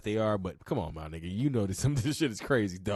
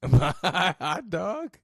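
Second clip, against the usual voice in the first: laughing; 10 semitones higher, 6 dB louder, much slower than usual. Retold or imitated. Laughing repeat of clip line.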